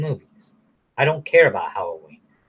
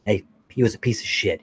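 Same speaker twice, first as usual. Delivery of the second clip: usual speaking voice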